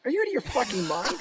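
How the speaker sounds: high, laughing are you out of your mind; cracking up in disbelief. Retold or imitated. Teller laughing at Wayne's choice to wait.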